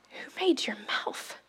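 hushed whisper of God's question. Retold or imitated. Preacher whispers what God asked her. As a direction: breathe the question with no voice. God's words retold as hush.

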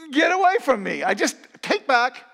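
high, loud outburst; acted loud protest. Preacher acting out someone taking words back, loud protest. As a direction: push it away in a high, loud voice.

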